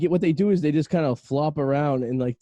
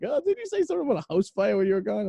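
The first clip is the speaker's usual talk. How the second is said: lightly voiced suspicious insurer, rising higher at the end; insurance company quote; 6 semitones higher than usual. Insurance company asking about the house fire, imitated suspicious questioning. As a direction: imitate a prying insurer with a high questioning lift.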